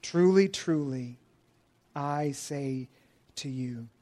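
soft and slow, almost hushed; hushed solemn quote. Retold or imitated. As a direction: let each word land quietly and gravely. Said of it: "What does he say?".

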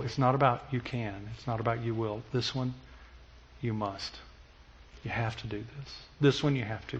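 soft but firm, no way out; firm quiet father. Retold or imitated. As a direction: quietly insist the child must do it. Father insisting firmly, quietly.

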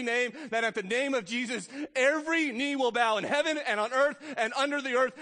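booming pulpit recitation; preaching at full force. Acted. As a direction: proclaim the verse high and loud, slowing on the last words.

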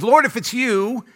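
acting out a daring disciple; testing Peter. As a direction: call it out slowly, testing the waters. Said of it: "starts to kinda test it".

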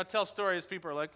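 usual speaking voice

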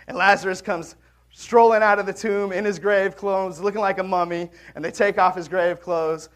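ordinary talk, nothing marked

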